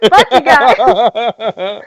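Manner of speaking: loud, drawn-out words through real laughter; helpless laughing. Real, not acted. Game master cracking up over the kazoo joke, laughing helplessly. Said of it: "I keep laughing at the".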